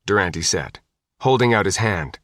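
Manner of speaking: ordinary delivery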